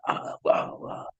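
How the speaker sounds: soft breathless whisper of amazement; awed whisper. Retold or imitated. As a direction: whisper it, utterly fascinated. Les awed by driveway talk.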